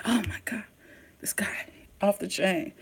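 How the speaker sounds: breathy stunned whisper. Retold or imitated. Vlogger whispering his shock at the villain, stunned whisper. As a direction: whisper it in awe. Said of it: "I said".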